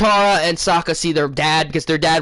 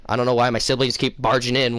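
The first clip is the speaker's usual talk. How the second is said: ordinary delivery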